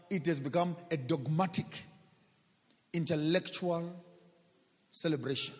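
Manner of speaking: ordinary talk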